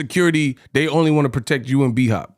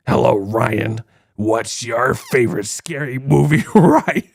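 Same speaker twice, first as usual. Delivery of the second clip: low creepy phone caller, breaking into laughter; the horror-movie killer asking a question; Ghostface voice; 4 semitones lower than usual. Retold or imitated. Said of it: "Scream phone call joke".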